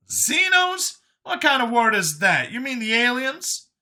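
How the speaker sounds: high, loud, incredulous questioning; Brian's incredulous voice. Acted. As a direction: pitch way up in disbelief as Brian. Brian questions an unknown word.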